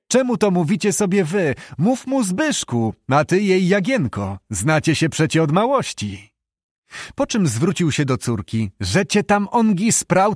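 lightly acted jovial elder; jolly father. A narrator's reading. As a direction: voice a merry father urging the young on.

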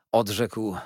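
plain reading voice

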